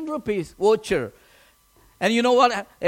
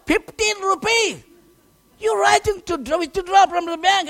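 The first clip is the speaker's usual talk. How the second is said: mocking surprise; 9 semitones higher than usual. Friend mocks the small withdrawal.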